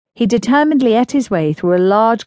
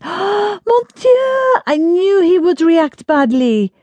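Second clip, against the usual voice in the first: high, startled cry of Mon Dieu, then slower; a Frenchwoman throwing up her hands in alarm, pitched high; dismayed French exclamation; 11 semitones higher, much slower than usual. Acted. Angelique hears the Duke reacted badly, startled high cry.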